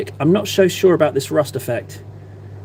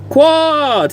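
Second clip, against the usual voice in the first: calling out; 11 semitones higher, 4 dB louder than usual. Real, not acted. Greeting chat viewer by name.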